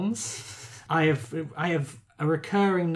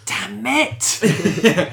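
loud, higher mock cry of annoyance; mock frustrated outburst; 6 semitones higher, 7 dB louder than usual. Joking about the film rights Marvel lacks, mock outrage. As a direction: blurt it out in comic exasperation.

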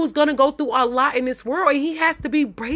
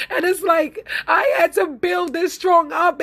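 tearful; 5 semitones higher than usual. Real, not acted. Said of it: "you guys have me up here, crying".

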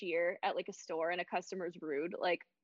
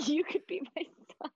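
amused, higher-pitched echo through laughter; laughing repeat; 7 semitones higher, 3 dB louder, faster than usual. Real, not acted. Listener laughs, repeating the line.

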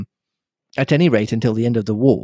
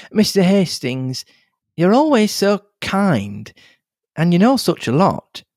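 put-on higher, lively young woman's voice; a chatty, bubbly young nurse; young girl Cynthia; 6 semitones higher than usual. A young nurse gossips to Hastings, lively and higher.

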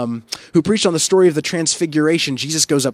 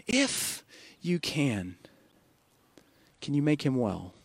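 softer, slower, almost fading out; faltering plea; 7 dB quieter, slower than usual. Acted. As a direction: let the plea sink to a hush. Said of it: "You can hear the pain in this father's voice".

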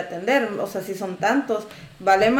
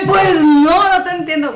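high, louder snapped retort; retold loud retort; 6 semitones higher, 8 dB louder than usual. Vendor retelling her retort to an insulting customer, loud. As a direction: snap back at the rude customer. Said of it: "Y yo".

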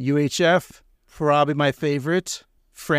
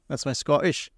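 ordinary talk, nothing marked

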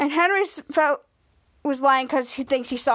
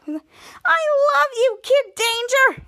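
very high, slow swooning call; hammed-up fan gushing at an idol; mock adoring fangirl; 10 semitones higher, 4 dB louder, much slower than usual. Fan declares love to hero.

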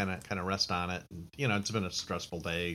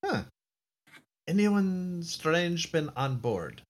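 slow higher captain voice; 8 semitones higher, much slower than usual. Acted. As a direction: ask it slowly, suspicious. Captain questions crewman.

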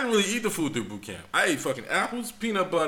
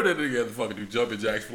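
ordinary delivery

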